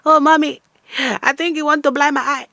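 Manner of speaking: put-on high, pleading whiny child voice; whiny daughter. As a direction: whine to mommy for help. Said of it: "You're calling your mother".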